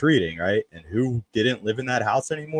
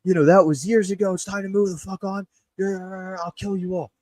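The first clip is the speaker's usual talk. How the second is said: furious screaming; 10 semitones higher than usual. Retold or imitated. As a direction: bellow it out into the dark yard. Dad's rant continues.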